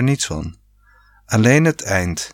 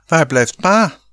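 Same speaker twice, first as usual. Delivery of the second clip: high, louder call across the pool; 6 semitones higher, 4 dB louder than usual. A narrator's reading. Rob in the pool calling for dad, loud high call. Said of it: "riep Rob".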